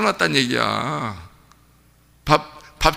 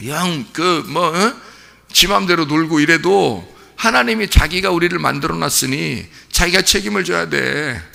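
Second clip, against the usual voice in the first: normal talking voice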